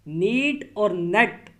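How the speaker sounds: नीट held long, नेट cut short; long versus short. Shown as a demonstration. Teacher contrasting the two vowels slowly. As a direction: stretch नीट, clip नेट. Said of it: "इन दोनों में फ़र्क sound का है".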